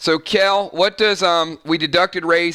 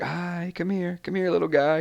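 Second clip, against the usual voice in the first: sweet kitten voice; 3 semitones higher, 6 dB quieter than usual. Shown as a demonstration. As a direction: coo it gently like calling a kitten. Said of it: "like, sweet kitten voice".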